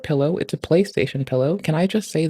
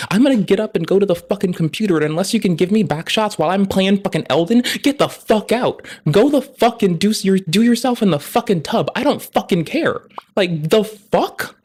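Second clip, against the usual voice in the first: escalating exasperated rant, louder and higher; rising rant; 5 semitones higher, 3 dB louder than usual. Real, not acted. To the viewers about marathon partners, a loud exasperated rant. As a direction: build the rant up, faster and higher.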